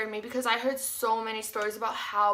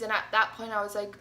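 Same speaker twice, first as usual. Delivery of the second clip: normal talking voice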